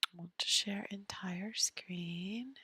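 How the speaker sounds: whispered to herself, slow; slow private whisper at the screen. Real, not acted. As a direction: mutter it under your breath while clicking. Muttering to self at computer.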